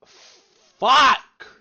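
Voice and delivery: explosive high-pitched shout; frustrated swearing. Real, not acted. Viewer cursing over greed, frustrated outburst. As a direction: burst out with one very loud curse.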